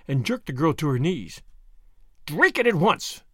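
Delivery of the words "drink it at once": imperious command, raised in pitch. A narrator's reading. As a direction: order it as one who expects obedience.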